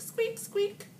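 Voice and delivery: high squeaky imitation of a chair; squeaky chair noise; chair squeak. A narrator's reading.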